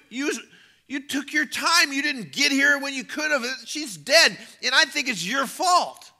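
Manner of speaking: high, mounting shout of blame; angry accusation. Acted. Grieving mourner blames Jesus for coming late, angry. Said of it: "you just wanna punch him".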